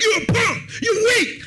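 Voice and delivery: high loud taunt; taunting shout. Retold or imitated. Man yelling taunts at the fleeing soldier, high and loud. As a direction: jeer it out the window. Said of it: "The dude out the window like".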